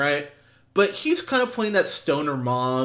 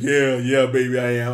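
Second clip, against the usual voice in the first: smug deeper voice; 3 semitones lower than usual. Retold or imitated. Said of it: "his voice is deeper".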